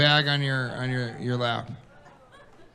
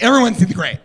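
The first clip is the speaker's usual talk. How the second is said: put-on indignant girlfriend; 5 semitones higher, 7 dB louder, faster than usual. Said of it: "She's like".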